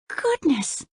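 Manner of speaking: high startled gasp of a word; high startled Goodness; surprised cry. A narrator's reading. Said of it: "cried Princess Cora".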